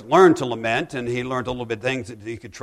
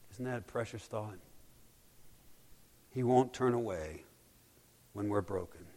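tender, hushed preaching; 3 semitones lower, 10 dB quieter than usual. Acted. Pastor comforting the grieving, soft and tender. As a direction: lower your voice to comfort the broken.

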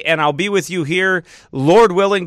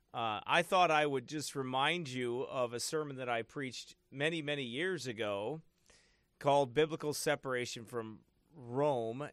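really quiet; 15 dB quieter than usual. Real, not acted. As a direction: speak barely above a murmur. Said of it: "Do I sound really quiet?".

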